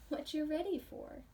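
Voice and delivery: usual speaking voice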